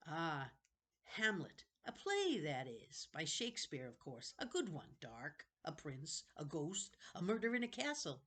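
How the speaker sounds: a wheedling ancient keeper of books; creepy old librarian. Acted. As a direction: play a strange, eager old librarian. Librarian character voice.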